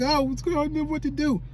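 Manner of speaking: high, distressed plea; retold crying. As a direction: relive the helpless tearful moment. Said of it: "but I was crying".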